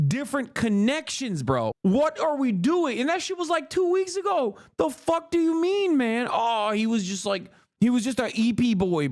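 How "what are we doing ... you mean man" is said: high, exasperated disbelief; incredulous rant. Real, not acted. Streamer ranting at the press secretary, incredulous and high. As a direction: pitch climbing in angry disbelief.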